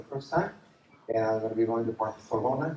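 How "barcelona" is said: Barcelona with the c as th; Spanish lisp. Shown as a demonstration. Preacher showing off his tour stop, Barcelona with Spanish lisp. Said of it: "You gotta say it like that".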